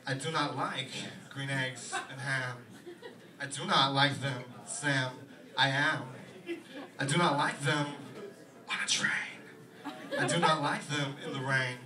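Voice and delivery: halting, oddly paused Walken reading; Christopher Walken impression. Christopher Walken reading a children's book, odd slow pauses. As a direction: break the rhyme into strange pauses. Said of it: "he's gotta be like".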